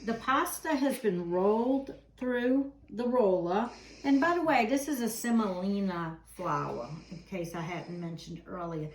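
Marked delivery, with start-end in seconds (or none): none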